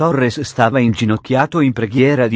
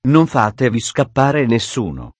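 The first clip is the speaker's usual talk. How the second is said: ordinary delivery, nothing marked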